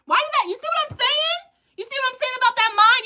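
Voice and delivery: very high, loud and amazed; you can't believe what you just heard; excited disbelief. Real, not acted. Host reacting to a viewer's message, loud amazed disbelief.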